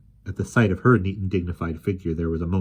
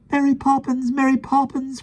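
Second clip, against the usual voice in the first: much higher, loud happy calling; joyful cry; 5 dB louder than usual. A narrator's reading. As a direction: call her name with delight. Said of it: "cried the elder brothers".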